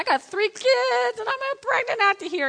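high, stretched-out complaint; overwhelmed mock wail; comic exasperation. Acting harried pregnant mother.

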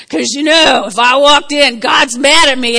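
ordinary talk

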